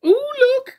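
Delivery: very high, loud gleeful cry; cried out. Acted. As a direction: point it out with glee. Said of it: "cried Little Miss Naughty".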